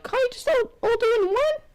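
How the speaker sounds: very high cartoonish squeak; squeaky high voice. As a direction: pitch way up into a silly squeaky voice. Retells a character's line.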